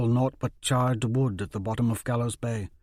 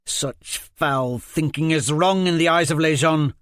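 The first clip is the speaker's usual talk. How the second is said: louder, higher righteous rebuke; angry scolding; 6 semitones higher, 6 dB louder than usual. Acted. Liz Hen scolds Jenko, loud righteous rebuke. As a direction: scold him sternly.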